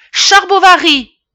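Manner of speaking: very high, very loud bellow; boy hollering his name in panic; full-lung shout. Acted. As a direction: blurt the name as if calling someone. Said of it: "lança à plein poumon".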